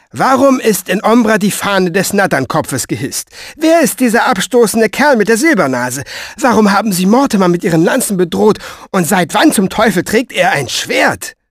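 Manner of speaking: high, loud volley of indignant questions; bossy woman interrogating. Acted. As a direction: fire off accusing questions.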